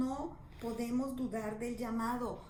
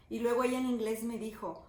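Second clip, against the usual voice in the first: ordinary talk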